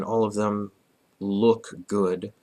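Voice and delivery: usual speaking voice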